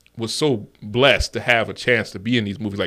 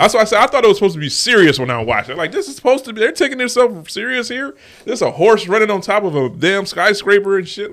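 excited rant; 10 semitones higher, 4 dB louder than usual. Real, not acted. Heated rant about the movie.

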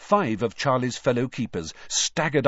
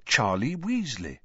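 usual speaking voice

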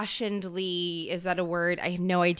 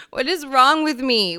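louder, throwing up hands; exasperated exclaim; 5 semitones higher, 8 dB louder than usual. Real, not acted. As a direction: exclaim it in dismay. Exclaims at herself.

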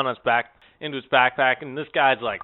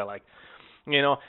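ordinary talk

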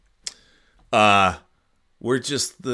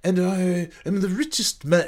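high, snooty mock blue blood accent; hammed-up rich blue blood voice; American blue blood accent; 8 semitones higher than usual. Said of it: "He's an American blue blood".

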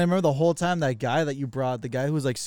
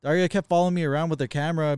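ordinary delivery

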